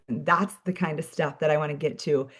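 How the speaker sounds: normal talking voice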